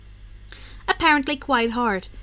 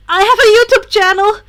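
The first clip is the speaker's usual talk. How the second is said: put-on boyish voice; 9 semitones higher, 13 dB louder than usual. Izuku voiced in story.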